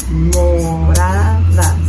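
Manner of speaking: Moradas loud and slow, Mo-ra-das; syllable by syllable. Shown as a demonstration. Teacher counting with children, Moradas in slow loud syllables. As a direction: clap out Moradas in three loud, long beats. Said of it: "contar las sílabas".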